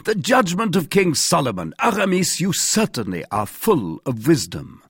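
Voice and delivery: high-pitched, lively acclaim; crying out. A narrator's reading. Guardsmen praise Aramis's wisdom of Solomon, crying out. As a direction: exclaim it grandly, pitch leaping up then settling. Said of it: "cried the other two guardsmen".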